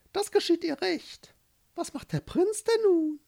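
very high cooing bird voice; gossiping pigeons. Acted. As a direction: coo loudly, then chatter up high like a pigeon. (schimpfende Taube mit sehr hoher Stimme)

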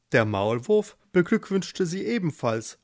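ordinary delivery, nothing marked

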